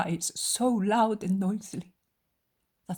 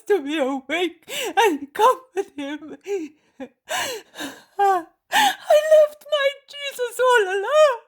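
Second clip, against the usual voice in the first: very high, loud crying voice; anguished tearful outcry; weeping confession; 10 dB louder than usual. Acted. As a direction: sob out the guilt. John confesses his cowardice weeping.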